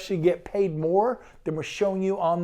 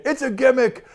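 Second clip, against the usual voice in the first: higher, louder mock New Jersey accent; put-on New Jersey accent with some punch; 6 semitones higher, 5 dB louder than usual. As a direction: have a go at a New Jersey guy. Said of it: "trying to do my best New Jersey accent".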